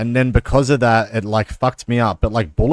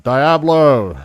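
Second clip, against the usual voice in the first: called-out answer, higher and a little louder; mock yell from the back; 5 semitones higher, 3 dB louder than usual. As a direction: shout it from the back of the room. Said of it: "the fat dude up the back yells out".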